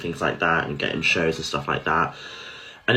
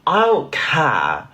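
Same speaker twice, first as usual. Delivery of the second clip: defiant outburst; 5 semitones higher, 4 dB louder than usual. Real, not acted. Ranting about men on live.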